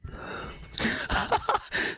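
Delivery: breathless high-pitched laugh; helpless squeaky laugh; high wheezy laugh. Real, not acted. Mocking accent banter.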